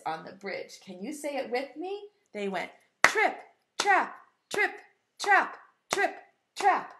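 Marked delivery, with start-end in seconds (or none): 3.0-6.9 high, crisp, separated beats; hoofbeat imitation. Shown as a demonstration. Teacher showing goat's hoofs on the bridge, rhythmic imitation. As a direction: tap out each word like hooves. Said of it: "went like this".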